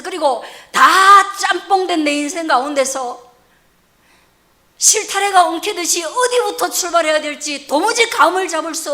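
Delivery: loud, high, impassioned sermon; building, shouted pulpit delivery; full-force preaching. Real, not acted. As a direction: cry it out to the whole congregation, driving every phrase.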